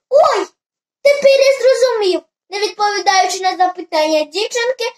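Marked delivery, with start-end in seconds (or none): 0.1-2.2 high, excited burst of realisation; lively raised-voice exclamation; exclaiming. A narrator's reading. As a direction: cry out as it suddenly dawns on you. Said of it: "вигукнув недоладько".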